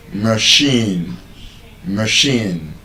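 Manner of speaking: machine, short uh then long ee; schwa in first syllable. Shown as a demonstration. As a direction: model machine with a weak muh. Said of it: "The A there is not a".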